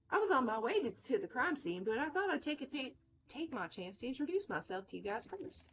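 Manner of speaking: put-on swooping Southern character accent; Mary Sason. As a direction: put on a friendly Southern twang. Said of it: "I don't know why she's Southern".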